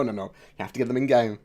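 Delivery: ordinary talk, nothing marked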